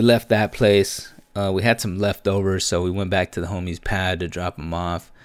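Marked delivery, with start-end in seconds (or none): none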